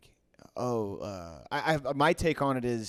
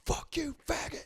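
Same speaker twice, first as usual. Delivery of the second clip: high, strained imitation of an enraged man; mock furious yell; 13 semitones higher, 5 dB quieter than usual. Beer smasher to the man filming him, mock furious yell. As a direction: play the raging man at a held-back volume.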